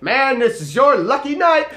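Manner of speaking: high, hyped-up good news; retold excited hype; excited announcement. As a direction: sell the good news with a punch. Retelling an upbeat announcement to buddy.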